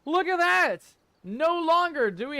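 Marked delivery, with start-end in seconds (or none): 0.0-0.8 high, loud burst of delight; excited. Real, not acted. Host calling out to viewers, excited and loud. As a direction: show it off to the mateys, thrilled.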